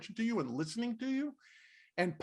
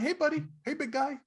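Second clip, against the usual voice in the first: higher, hearty mock hello, buddy-buddy; friendly big greeting; 9 semitones higher than usual. Comedian greeting him in the halls, hearty imitated hello. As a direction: imitate a pal's booming hello.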